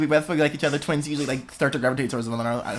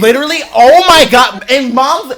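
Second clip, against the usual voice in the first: heated rant; 10 semitones higher, 10 dB louder than usual. Real, not acted. Heated rant about parents.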